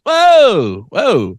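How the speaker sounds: high whoa falling off; mock startled barista. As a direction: stop him short in mock alarm. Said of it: "And she's".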